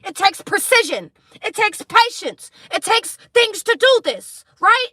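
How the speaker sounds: loud, high, preaching intensity; passionate shouting. Real, not acted. Tarot reader shouting her message at viewers. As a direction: hammer each point home loudly.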